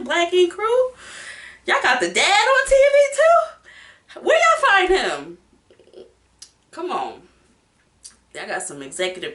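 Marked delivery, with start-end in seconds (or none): 0.0-7.2 much higher, louder incredulous call-out; hammed-up shock at the producers, pitched way up; playful outrage at the show, high and loud; mock disbelief. Mock addressing the show's producers.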